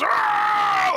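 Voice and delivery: high loud battle roar; bloodlusty scream. A narrator's reading. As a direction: let out a wild victory scream. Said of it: "huge kind of, like, bloodlusty scream".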